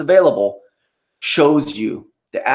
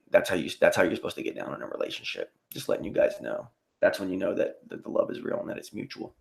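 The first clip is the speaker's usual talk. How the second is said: much lower and softer, trailing into a whisper; hushed aside; 7 semitones lower, 10 dB quieter, faster than usual. Real, not acted. Streamer confiding to chat about his woman, hushed. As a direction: confide it quietly.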